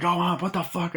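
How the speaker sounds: high startled outburst; a man caught off guard; mock shocked. Kurt reacts to the fight, high startled outburst.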